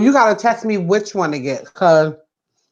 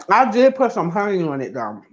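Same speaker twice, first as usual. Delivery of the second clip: ordinary talk, nothing marked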